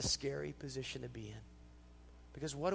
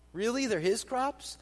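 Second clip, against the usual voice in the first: mock disbelief; 7 semitones higher, 6 dB louder than usual. Real, not acted. Preacher mocks the rich man's claim.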